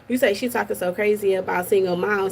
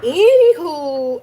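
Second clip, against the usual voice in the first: drawn-out anywho; 6 semitones higher, 8 dB louder, much slower than usual. Acted. As my girl P E would say.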